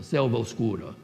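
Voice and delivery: Selva oscura said with selva and oscura run together as one syllable; Selva oscura elided. Shown as a demonstration. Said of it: "it's one syllable".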